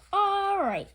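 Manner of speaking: high, loud ready reply; eager agreement. A narrator's reading. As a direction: agree brightly. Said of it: "said Amelia Bedelia".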